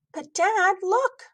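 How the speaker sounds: put-on high, pleading kid voice; a kid begging dad to look; whiny high "but Dad"; child protest.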